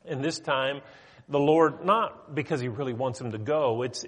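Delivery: normal talking voice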